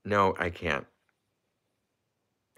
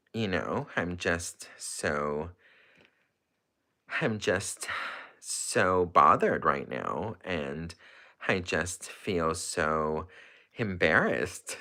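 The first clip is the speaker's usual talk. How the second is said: slow drawn-out celebrity impression with long pauses; Kourtney; slower than usual. Embarrassed streamer hiding behind an impression, drawling celebrity voice. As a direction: drag out the words, pitch swooping on key ones. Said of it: "I'm just gonna do my Kourtney".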